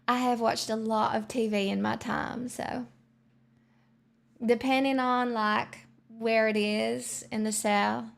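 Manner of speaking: Southern US country accent. Shown as a demonstration. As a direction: slip into an American country accent. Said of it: "I can definitely nail a country accent".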